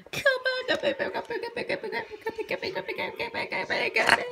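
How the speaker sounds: very high squeals through laughter; laughing pleading. Real, not acted. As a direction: giggle while calling it back. Begging the pig back, laughing.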